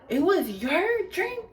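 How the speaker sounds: high, rising disbelieving question; mock incredulity. Girl learning whose drink it was, imitated incredulity. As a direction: swoop up in exaggerated disbelief.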